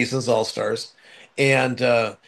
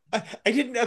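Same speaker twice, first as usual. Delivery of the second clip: high, stammering mock embarrassment breaking into laughter; pitched-up, giggly fluster; flustered, laughing imitation; 9 semitones higher, 4 dB quieter, much faster than usual. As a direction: imitate someone brushing off a slip, laughing. Flustered nurse's reply, acted out.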